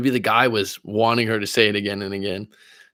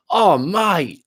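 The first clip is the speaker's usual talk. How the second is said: put-on high, exclaiming London accent; 9 semitones higher, 4 dB louder than usual. Host recalling one guy who complained to him, mock London accent. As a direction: imitate an exasperated Londoner in a high voice. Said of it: "a London accent".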